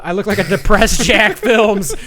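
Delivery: amused self-mocking outburst; laughing. Real, not acted. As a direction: crack up at your own joke mid-sentence. Laughs at himself mid-announcement.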